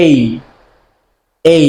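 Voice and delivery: "A" as a careful long diphthong; correct letter A. Shown as a demonstration. Teacher demonstrating alphabet sounds to learners, correct pronunciation of "A". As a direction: say "A" slowly as a clean ey glide. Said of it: "the correct pronunciation of twenty-six".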